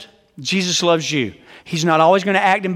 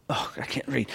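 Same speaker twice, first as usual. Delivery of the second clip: unvoiced aside under the breath; half-voiced mumble to himself; whispered aside; 5 semitones lower, 14 dB quieter than usual. Real, not acted. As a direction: whisper it to yourself. Aside to self while reading.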